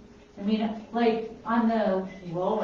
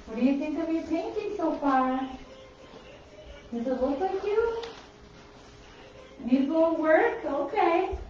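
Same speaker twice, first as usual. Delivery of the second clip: talking to a dog; 6 semitones higher than usual. Real, not acted. Talking to her dog while painting.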